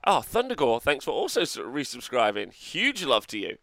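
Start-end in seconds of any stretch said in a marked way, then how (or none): none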